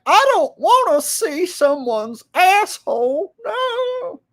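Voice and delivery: put-on very high, slow old-lady squeak; shocked grandma. Grandma recoils from a channel called Jake the Asshole. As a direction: squeal it like an outraged granny. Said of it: "she'll be like".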